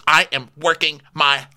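halting, emphatic mock-weepy protest; put-on tearful indignation. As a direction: break it into slow, upset chunks. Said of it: "[name] drops her tears immediately".

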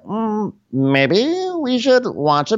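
high, slow, drawn-out imitation; mock coy suggestion. Imitating Lindsay cuddling on the floor, high coy drawl. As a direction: stretch it out in a high put-on voice.

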